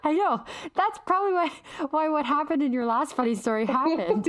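laughing. Real, not acted. Teasing sister, both laughing.

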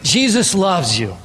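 drawn-out Jesus loves you; slow familiar phrase; slow, ordinary recital. Shown as a demonstration. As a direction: say it slow and routine. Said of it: "I can say to you".

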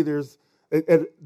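normal talking voice

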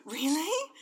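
high, loud, rising question; delighted surprise. Retold or imitated. Mum hears her son's praise, happily surprised.

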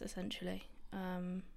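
ordinary delivery

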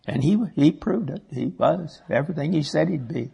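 usual speaking voice

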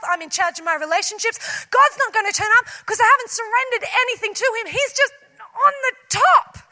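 high, loud, defiant outburst; silent scream. Retold or imitated. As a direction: rant like a panicked control freak. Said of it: "we silently scream".

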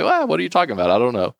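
high-pitched, playing dumb on well; mock innocent denial. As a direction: protest innocence like a caught servant. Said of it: "You say".

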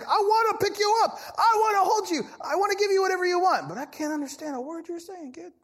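put-on high, loud, doting baby-talk voice; doting father God. God as a father talking to his baby, high and doting. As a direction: coo over a child you can't understand.

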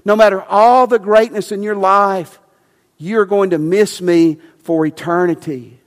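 high, loud and urgent, slowing on key words; passionate plea. Retold or imitated. Jesus pleading with Nicodemus, passionate and loud. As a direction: plead with him like his soul depends on it. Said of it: "This was with passion".

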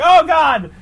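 high shocked yelp; a sudden high cry of alarm; mock alarm. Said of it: "And I'm like".

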